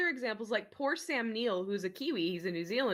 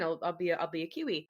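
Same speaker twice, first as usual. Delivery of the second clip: low, softer mock grumble; resigned compliance; 6 semitones lower, 4 dB quieter than usual. Actor yielding to director's change, low resigned voice. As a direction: give in, voice sinking.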